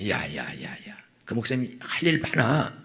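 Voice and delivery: low, soft grumbling husband; low-pitched, soft-spoken husband waving it away; dismissive husband. Acted. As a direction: brush the wife off in a low, muted voice. Husband brushes off wife's church plan.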